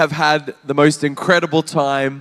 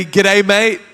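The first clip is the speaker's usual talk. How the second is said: laconic outback drawl; 3 semitones higher than usual. Shown as a demonstration. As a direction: greet them like an Aussie bloke.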